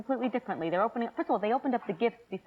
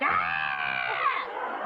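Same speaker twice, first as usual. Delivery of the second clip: hammed-up shouted scream; mock family screaming. Family opening bridal gifts, mock screaming. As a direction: scream like the rowdy relatives. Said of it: "they're screaming, they're shouting, they're yelling".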